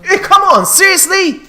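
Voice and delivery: high, loud exasperated shout; frustrated yell. Real, not acted. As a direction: yell in disbelief. Frustrated at the game.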